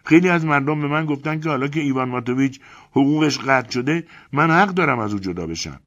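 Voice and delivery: high, loud furious woman's voice; angry shriek. Acted. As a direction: Elena yells at narrator for lecturing her, furious. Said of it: "جیغ کشید و با صدای بلند گفت".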